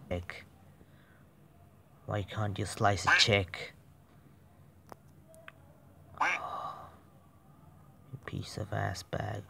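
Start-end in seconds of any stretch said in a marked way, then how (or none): none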